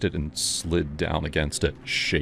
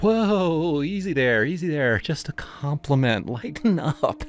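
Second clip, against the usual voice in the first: higher, sleazy grinning voice; creepy delivery guy; 9 semitones higher than usual. Acted. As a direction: play him smug and off-kilter.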